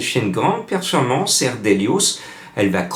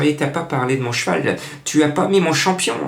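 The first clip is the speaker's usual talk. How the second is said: higher-pitched voice of an interrupting horse owner; Madame Colombo; 6 semitones higher than usual. Acted. Owner interrupts tipster about her champion, higher-pitched protest. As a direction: pipe up in protest, pitch climbing. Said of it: "c'est Madame Colombo qui m'interpelle en disant".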